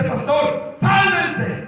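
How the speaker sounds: high, desperate alarm. Retold or imitated. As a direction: call out in panic to abandon the canoe. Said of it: "el hermano se empezó a desesperar".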